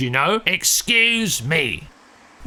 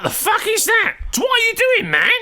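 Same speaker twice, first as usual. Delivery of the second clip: shocked shout; 14 semitones higher than usual. Acted. Friend catching him at something gross, shocked yell. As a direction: blurt it out in horrified disbelief. Shocked at licking plaster cast.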